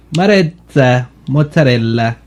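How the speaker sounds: ordinary talk, nothing marked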